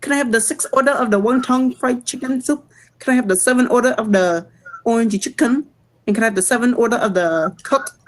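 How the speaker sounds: put-on high-pitched Vietnamese accent, ordering takeout. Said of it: "my Vietnamese voice".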